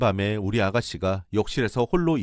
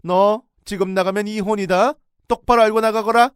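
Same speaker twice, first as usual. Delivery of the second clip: much higher and louder threat; threatening yell; 12 semitones higher, 10 dB louder than usual. Retold or imitated. In-law threatens daughter-in-law with divorce, yelling. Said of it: "다시 소리를 질렀어요".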